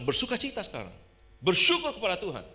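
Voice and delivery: forceful, rousing exhortation; emphatic order, building in volume; retold pastoral command. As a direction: command it loudly, as if rallying someone. Retold command to rejoice and give thanks.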